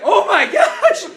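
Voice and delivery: loud, high, stretched exclamation; hammed-up alarm; retold panicked cry. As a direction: cry out in mock horror, drawing it out. Retold horror at cousin being shot.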